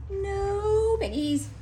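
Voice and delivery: slow, high hen voice; clucking chicken. A narrator's reading. Chicken answers Grinch, clucking. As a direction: cluck the words.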